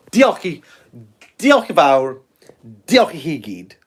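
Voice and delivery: "Diolch" with Welsh throaty ch; Welsh thanks. Shown as a demonstration. Thanking viewers in Welsh, careful Welsh pronunciation. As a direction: say "diolch i chi gyd" the Welsh way. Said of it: "as we say in Welsh".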